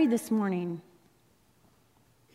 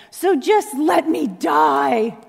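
slow, louder hammed-up despair; melodramatic Jonah; 4 semitones higher, 7 dB louder, slower than usual. Jonah sulking to God, mock melodramatic despair. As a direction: overact a wounded sigh of a wish to die. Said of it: "The Academy Award goes to Jonah".

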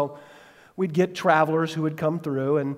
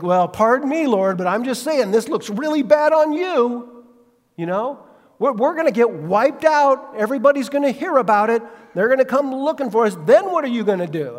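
higher, louder whiny indignant rant; pity party; 7 semitones higher, 5 dB louder than usual. Retold or imitated. Joshua throwing a pity party at God, hammed-up whine. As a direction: sulk and scold God at once. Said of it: "has this gigantic pity party".

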